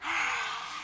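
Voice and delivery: long breathy roar exhale; lion breath. Shown as a demonstration. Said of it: "One more time".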